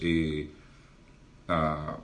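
normal talking voice

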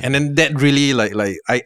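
usual speaking voice

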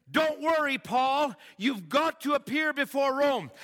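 high, loud comforting call; divine reassurance. Retold or imitated. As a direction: reassure him boldly. God reassuring Paul in vision.

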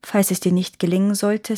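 ordinary delivery, nothing marked

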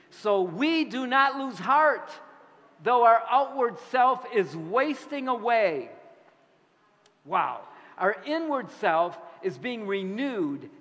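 loud, slow, high scripture proclamation; scripture reading. Acted. Preacher reading Paul's letter aloud to the congregation, loud and slow. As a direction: proclaim the verse with awe. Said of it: "listen to what Paul wrote".

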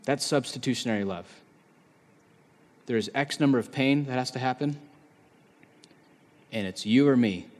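ordinary delivery

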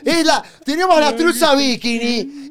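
hammed-up hawker call; street vendor voice. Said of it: "así como lo estás diciendo".

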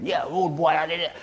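put-on Jamaican MC voice; caricatured Jamaican accent. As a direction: do a bit of Jamaican patois. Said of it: "a bit of Jamaican".

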